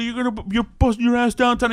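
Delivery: mock police threat; tough-guy cop. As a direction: play the bullying beat cop. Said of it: "tough guy move".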